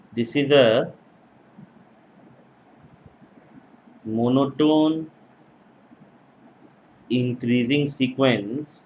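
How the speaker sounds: slow, spaced-out lecture dictation. Real, not acted. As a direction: dictate phrase by phrase for note-takers. Dictating for students to write.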